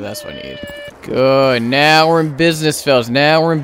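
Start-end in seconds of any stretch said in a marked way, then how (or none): 0.0-0.6 whisper. Real, not acted. Hushed to himself.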